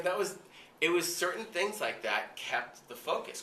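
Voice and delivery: ordinary talk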